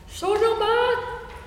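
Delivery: high, slow, ringing shout; peasant's bold cry, pitched high; loud, clear yell. Acted. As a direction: yell the name out, stretching each word. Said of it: "urlò forte e chiaro".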